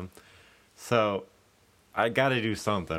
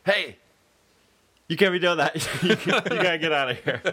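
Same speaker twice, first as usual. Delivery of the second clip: higher-pitched mock bartender telling someone off; raised, mock-stern objection; acted annoyed bartender; 6 semitones higher, faster than usual. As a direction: imitate a bartender ordering a drunk out. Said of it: "the bartender walks over and goes".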